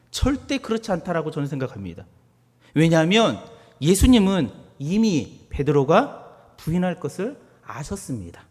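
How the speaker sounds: normal talking voice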